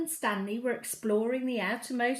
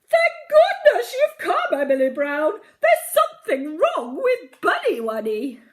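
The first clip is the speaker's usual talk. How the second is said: very high, loud wailing queen; naughty queen; 8 semitones higher, 12 dB louder than usual. A narrator's reading. Naughty queen greeting Emily Brown, high and wailing. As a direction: squeal it like a spoilt sobbing queen. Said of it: "crying like anything".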